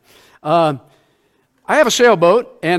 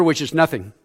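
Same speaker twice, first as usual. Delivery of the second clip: ordinary talk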